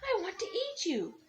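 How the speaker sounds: put-on high-pitched old man voice. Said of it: "cried the little old man".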